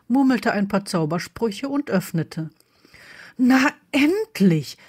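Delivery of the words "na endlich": drawn-out, exclaimed relief; impatient higher exclamation; called out. A narrator's reading. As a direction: call it out slowly, pitch lifting. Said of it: "rief Lily".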